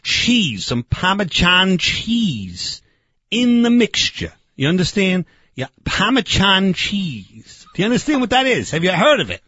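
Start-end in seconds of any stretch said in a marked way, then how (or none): 0.8-2.8 Parmesan drawn out slowly as "pah-ma-chahn"; mangled Italian pronunciation. Acted. Barbecue man naming the secret ingredient, mispronounced. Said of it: "not the way they say it in Italy". 5.8-7.7 slow, emphatic "pah-ma-chahn" for Parmesan; mangled Italian pronunciation. Acted. Barbecue man repeating it for the fondue guy, mispronounced. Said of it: "not the way they say it in Italy".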